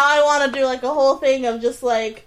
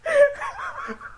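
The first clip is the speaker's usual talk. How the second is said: laughing fit; 7 semitones higher than usual. Real, not acted. Fit of giggles over names.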